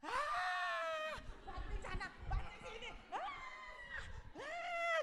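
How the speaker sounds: high strained "aah" of rage; mock tantrum yells, very high; angry scream. David alone in his tent, raging. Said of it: "marah-marah dia".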